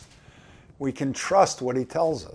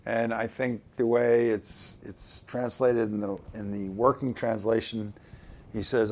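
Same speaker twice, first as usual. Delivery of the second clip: normal talking voice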